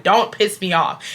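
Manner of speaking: ordinary delivery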